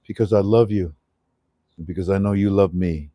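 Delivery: earnest spoken prayer, low and steady. Real, not acted. Host opens his show praying to God, earnest prayer voice. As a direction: pray sincerely to the Father.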